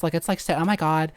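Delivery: put-on YouTuber valley girl voice. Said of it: "She's, like, talking like this".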